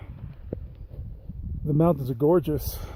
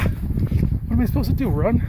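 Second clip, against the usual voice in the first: high-pitched, exasperated cry; pained exasperation; 8 semitones higher, slower than usual. Real, not acted. Hiker stuck on scorching sand, pained exasperation. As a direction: blurt it out while your feet burn. Feet burning on hot sand.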